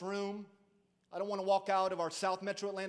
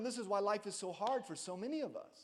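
ordinary talk

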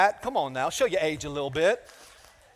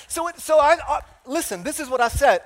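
excited buildup; 8 semitones higher, 5 dB louder than usual. Real, not acted. Pastor builds up to his story.

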